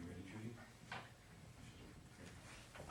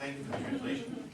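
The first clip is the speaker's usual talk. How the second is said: much higher, playful thanks; 13 semitones higher, 3 dB louder than usual. Real, not acted. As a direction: pitch it way up teasingly.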